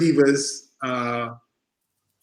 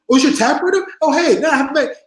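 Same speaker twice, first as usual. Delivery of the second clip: high, loud retold excitement; sudden friendly recognition; 11 semitones higher, 4 dB louder than usual. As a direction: imitate realizing who it is, raised voice. Acting out Bret's sudden recognition.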